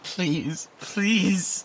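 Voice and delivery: second please rises high and loud; pleading please, climbing to a cry; desperate plea. Acted. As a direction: beg her outright. Desperate begging.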